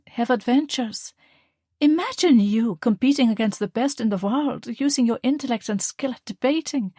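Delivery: beam with pride at your daughter; proud mother. Acted. Mother praising her daughter's debating win, proud.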